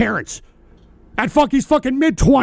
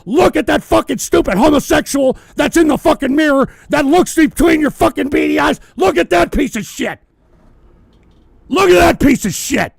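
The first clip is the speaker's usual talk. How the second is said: furious yelling; 4 semitones higher, 4 dB louder than usual. Real, not acted. Streamer yelling at a chatter to get a job, furious shout. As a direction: bellow every word at him.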